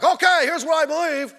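high, loud, pushy declaration; brash announcement. Acted. Preacher mimicking someone jumping up to declare beliefs, brash and loud. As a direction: jump in and announce it loudly. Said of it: "don't s-, jump up like".

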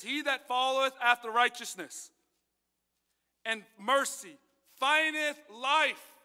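slow, weighty scripture read; solemn King James reading. Acted. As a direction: draw out findeth life.

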